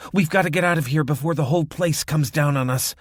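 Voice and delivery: loud, high urgent plea; urgent shout. Acted. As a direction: push it out in panic. Fleeing burning cabin, urgent.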